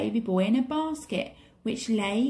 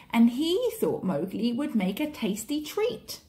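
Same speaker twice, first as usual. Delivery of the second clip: plain reading voice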